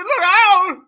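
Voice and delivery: loud, high imitation shout; mimicking a frantic call; mock warning yell. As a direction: yell it like a panicked warning, in jest. Mimics the played man's warning yell.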